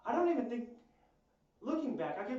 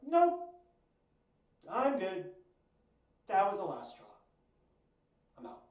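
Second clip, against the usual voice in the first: loud, higher and curt, done with it; dismissive loud refusal; 5 semitones higher, 8 dB louder than usual. Retold or imitated. Friend imagined cutting ties, loud and dismissive. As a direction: slam the door on the friendship out loud.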